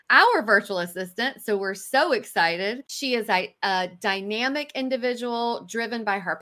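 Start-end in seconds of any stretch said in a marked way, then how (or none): 0.1-1.4 our said louder and lifted; stress on our. Real, not acted. Introducing guest to listeners, stressing our. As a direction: punch the word our.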